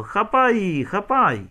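high, urgent narrated shout; storyteller's chase yell, high-pitched; shouted order. A narrator's reading. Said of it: "крикнув Людчин батько".

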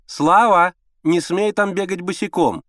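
loud, high motherly command; stern calling. A narrator's reading. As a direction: forbid him from the other room. Mother sharply orders her son.

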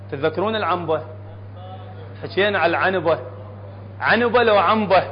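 ordinary talk

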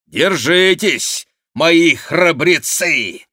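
high, loud, drawn-out rallying call; боевой клич. Acted. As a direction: call out to the fighters, slowly and high. Призыв к бою. (громкий протяжный призыв к бою)

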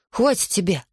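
usual speaking voice

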